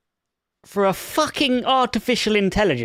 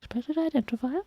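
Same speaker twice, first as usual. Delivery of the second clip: higher, softer mocking repeat of the error; incredulous mock echo; 7 semitones higher, 9 dB quieter than usual. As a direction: parrot the message back in disbelief.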